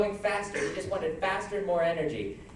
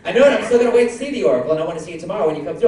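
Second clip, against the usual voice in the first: fast talking; 2 semitones lower, 4 dB louder, faster than usual. Real, not acted. Fast reply to speed joke.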